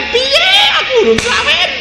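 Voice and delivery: high, wailing mock cry; mock panicked lover. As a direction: wail it like a panicking unmarried lover. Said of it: "Yang pacaran belum kawin". (ratapan pura-pura dengan suara tinggi)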